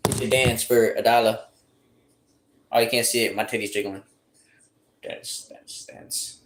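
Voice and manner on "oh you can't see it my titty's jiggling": usual speaking voice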